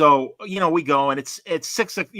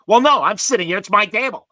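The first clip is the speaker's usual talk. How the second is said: loud, high mock outrage; indignant objection; 7 semitones higher, 11 dB louder than usual. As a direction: play the little guy jealously guarding his seat. Retelling stranger guarding his table.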